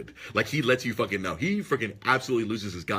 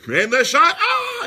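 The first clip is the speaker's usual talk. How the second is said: much louder call breaking into a higher shriek; Gus Johnson screaming; 9 dB louder, slower than usual. Retold or imitated. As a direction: build the call then scream into the mic. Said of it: "He freaking screams in the mic".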